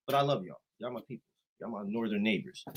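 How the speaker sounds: suddenly soft, fond reassurance; affectionate backing down. Real, not acted. Making up with Canadian northern neighbors, soft affection. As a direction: drop the taunt and soothe them quietly. Soft make-up after trash talk.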